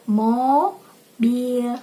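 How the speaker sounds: syllables stretched and set apart; very slow teaching. Shown as a demonstration. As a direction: model the word very slowly for a child. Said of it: "saya bicaranya pelan-pelan, diulang-ulang". (ucapan pelan, suku kata dipanjangkan)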